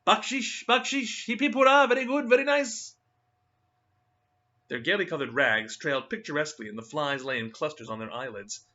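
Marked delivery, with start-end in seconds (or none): 0.1-2.9 high, whining begging chant; plaintive begging children. Acted. Ragged children running beside tourists, high plaintive begging. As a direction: beg for coins in a thin high pleading voice. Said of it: "murmuring plaintively".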